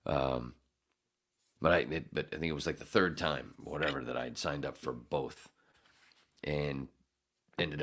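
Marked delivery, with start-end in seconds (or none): none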